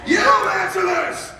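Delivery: high, drawn-out victory yell; triumphant shout. Retold or imitated. Dad celebrating son's miracle shot, triumphant drawn-out yell. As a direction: holler it long and high.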